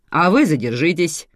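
stern boss detaining a passenger; commanding. A narrator's reading. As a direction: stop her with an official command. Boss orders woman to stop.

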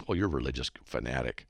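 lightly mocking, under the voice; snickering attitude. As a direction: mimic a mildly scornful brush-off. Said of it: "That kind of attitude".

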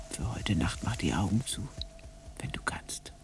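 soft bedtime whisper. Acted. Whispering goodnight to the homesick llama. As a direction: hush the creature to sleep.

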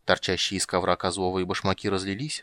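ordinary delivery, nothing marked

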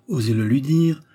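ordinary delivery

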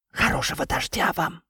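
soft, distant tree greeting; talking tree. Acted. (говорящее дерево)

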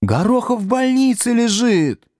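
put-on high-pitched girlish yell; girls shouting. A narrator's reading. Girls correct the lie to the teacher, shouting high. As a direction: cry out in objection, pitched right up. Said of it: "закричали девицы".

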